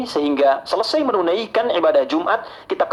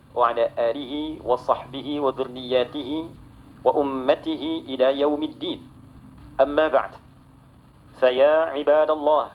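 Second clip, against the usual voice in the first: low, soft, measured liturgical recitation; Arabic khutbah opening; 3 semitones lower, 4 dB quieter, faster than usual. Real, not acted. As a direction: recite the opening praise in an even, subdued tone.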